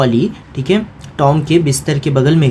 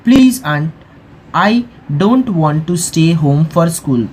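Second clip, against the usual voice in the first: slow textbook reading; much slower than usual. A narrator's reading. Teacher reads English text aloud.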